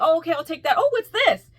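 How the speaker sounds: high, loud, eager burst; overeager excitement. Retold or imitated. Customer to pastry seller, excitedly asking about every treat. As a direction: pounce on the next treat, pitch jumping up. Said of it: "you were overwhelming".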